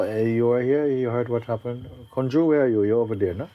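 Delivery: ordinary talk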